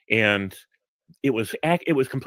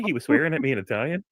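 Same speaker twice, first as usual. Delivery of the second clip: normal talking voice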